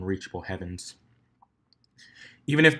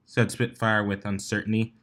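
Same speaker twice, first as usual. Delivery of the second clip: ordinary delivery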